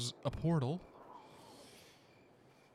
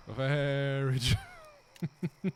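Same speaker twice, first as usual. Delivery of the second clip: very slow mock agreement; much slower than usual.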